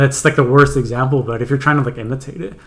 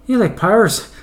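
lightly put-on Irish accent, higher; Irish accent, raised questioning pitch; Irish grandfather; 7 semitones higher than usual. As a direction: imitate an Irish granddad asking. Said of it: "And my grandfather goes".

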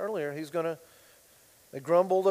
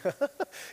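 short laugh; 8 semitones higher, 3 dB quieter than usual. Real, not acted. Laughs at his own joke.

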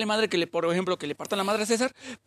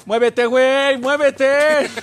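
loud, raised-pitch mock order to move; acted shout; 4 semitones higher, 7 dB louder, much slower than usual. Telling a guy to get moving, mock shouting. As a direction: call it out louder and higher, playing it up. Acts out yelling at someone to move.

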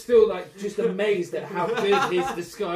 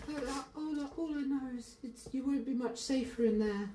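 put-on high pinched scared child; 4 semitones higher, 5 dB quieter than usual. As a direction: warn them like a frightened boy. GM voices crying kid.

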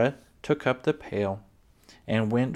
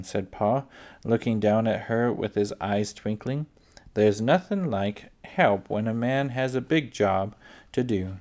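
ordinary delivery, nothing marked

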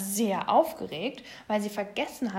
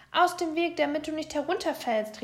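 raised-pitch warning from a mother bear; calling out; 6 semitones higher, 4 dB louder than usual. A narrator's reading. Mama Bär warning the climbing little bear, calling out. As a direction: call up a warning to a child. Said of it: "rief Mama Bär".

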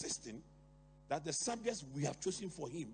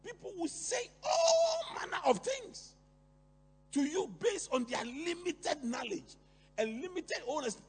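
rising preach; 10 semitones higher, 6 dB louder than usual. Real, not acted. Preacher rising to full force.

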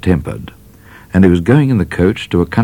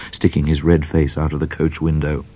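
usual speaking voice

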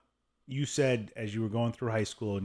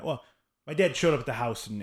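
ordinary delivery

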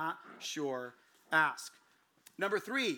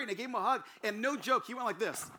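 ordinary talk, nothing marked

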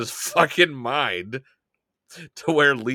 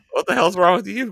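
raised, louder retort, short of a scream; mock indignant comeback; 7 semitones higher, 5 dB louder, faster than usual. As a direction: push back at the screamer, playfully affronted. Own comeback to the imitated scream.

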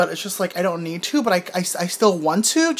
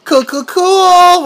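slow, sing-song "cool, cool, cool"; slow drawn-out; 9 semitones higher, 7 dB louder, much slower than usual. Real, not acted. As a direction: stretch each word out lazily. Drawn-out playful agreement.

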